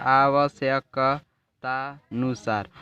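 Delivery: slow, syllable-by-syllable dictation; imitating a teacher's drawn-out dictation; teacher dictating. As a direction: draw out each syllable of आवश्यकतानुसार. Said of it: "अध्यापक बोल देता है".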